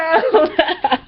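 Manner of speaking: breathless high giggle; high wheezing laugh. Real, not acted. Laughing at her own fart story. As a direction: crack up helplessly.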